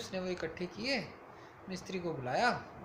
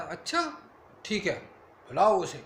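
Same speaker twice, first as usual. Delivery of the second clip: king's rising order; 8 dB louder than usual. Acted. Foolish king orders thief's victim summoned.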